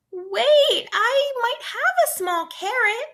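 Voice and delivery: put-on high, small child's voice; a shy little girl speaking up; timid child. A narrator's reading. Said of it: "A child timidly raised her hand".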